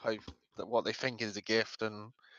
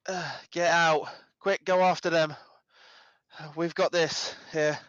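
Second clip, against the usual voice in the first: high, loud, pained orders; strained urging; 6 semitones higher, 4 dB louder than usual. Acted. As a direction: bark them out while hurting. Character pained, ordering guards out.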